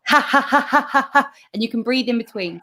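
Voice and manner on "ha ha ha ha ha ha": high, evenly spaced "ha" syllables; ha-ha laughter sound. Shown as a demonstration. Coach demonstrating the next sound, ha-ha laughter. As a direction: pump out "ha ha ha" high and regular. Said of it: "So the ha ha sound is".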